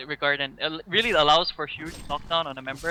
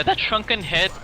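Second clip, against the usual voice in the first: voice cracking; 5 semitones higher, 6 dB louder than usual. Real, not acted. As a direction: voice cracks on shrunken head. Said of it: "my voice cracked".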